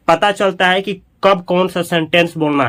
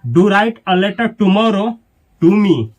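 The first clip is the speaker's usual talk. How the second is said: slow teaching read; 3 semitones higher, much slower than usual. Shown as a demonstration. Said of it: "तो कैसे बोलेंगे?".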